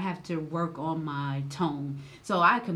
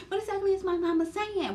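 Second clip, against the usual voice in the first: high, fast, feigned-innocent question; mimicking a clueless wife, high and quick; mock innocent; 11 semitones higher, faster than usual. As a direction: play dumb in a high voice. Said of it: "how she tries to just act like she just don't know".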